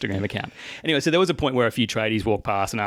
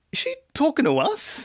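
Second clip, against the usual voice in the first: much higher, baffled crew voice; mock bewilderment. Actor voicing the crew as she shouts at them, high mock bewilderment. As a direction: imitate puzzled onlookers in a high pitch. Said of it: "everyone's kind of a bit like".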